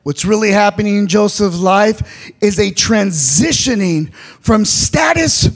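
sermon cadence building to a cry; full-force preaching. Acted. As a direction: preach it to the whole church.

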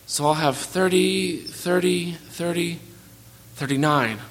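drawn-out counting, stretching each thirty; slow, labored sum like it's hard work; a boy slowly working out how many presents he'll get. Acted. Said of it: "he said slowly".

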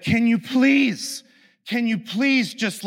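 drawn-out, high-pitched please; exasperated plea. Retold or imitated. To the partner who won't leave, a slow exasperated plea. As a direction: stretch it out, begging to be left alone.

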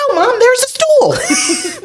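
high, drawn-out mock protest; slow, high-pitched indignant whine; exasperated child. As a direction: imitate a kid spelling out the obvious to Mom. Exasperated son retold to mother.

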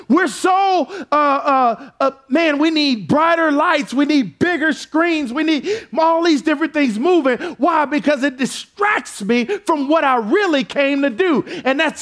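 high, shouting preacher climax; rising pulpit fervor; full-force preaching. Acted. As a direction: preach it hard to the congregation. Preaching continues.

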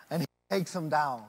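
normal talking voice

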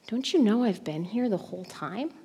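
soft questioning, well below preaching level; a quiet, gentle reproach; Jesus. Retold or imitated. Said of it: "if Jesus' question is more".

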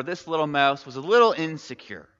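usual speaking voice

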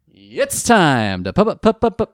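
stuttering on pu, rising loud; hammed-up stammer on pu; mock stutter on pu. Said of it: "I got the wrong kind of stutter".

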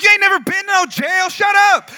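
loud, high incredulous shouting; exasperated scolding. Retold or imitated. Teen to friend who never saw jail, exasperated shouting.

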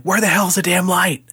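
high, exasperated mock yell; retold exasperation. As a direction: demand it as if stuck in the dark. Acted frustration stumbling in dark.

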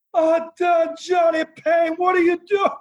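high yelling Iron Sheik voice; Iron Sheik impression. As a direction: bellow it like the old Sheik calling you out. Imitating the Iron Sheik's reaction.

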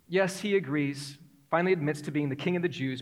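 ordinary delivery

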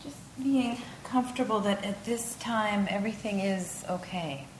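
unhurried yoga nidra voice with long pauses; guided meditation. Acted.

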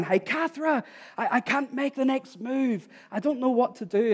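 high, strained-sounding call for help without full volume; retold shout down. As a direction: act out calling down in trouble. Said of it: "I was shouting down".

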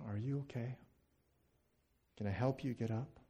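bend down to comfort the fallen; quietly offered help; Jesus's gentle concern. Acted. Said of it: "I imagine him bending down".

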